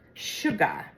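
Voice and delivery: "sugar" said as "shu-gah", final r dropped; imitated cute accent. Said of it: "She always says".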